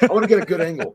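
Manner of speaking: laughing mock quote.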